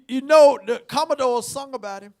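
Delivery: usual speaking voice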